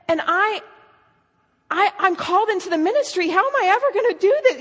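high, rushed mock despair; imitated distraught girl. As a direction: act out a girl falling apart, pitch climbing. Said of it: "she's just a mess".

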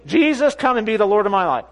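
imitating a forceful prayer leader; emphatic prayer for another to repeat; bold authority. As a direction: lead the prayer boldly and firmly. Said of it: "she was operating in authority. She had boldness".

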